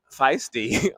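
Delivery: normal talking voice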